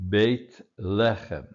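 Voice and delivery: Beit Lechem, slowly, with LE of Lechem stressed; stress on first syllable of Lechem. Shown as a demonstration. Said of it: "as we did the first time".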